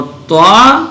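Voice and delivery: طا slow, as a thick "twaa"; heavy letter. Shown as a demonstration. Teacher listing heavy letters, slow thick "twaa". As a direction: hold طا long and rounded. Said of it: "جو موٹے پڑھے جاتے ہیں".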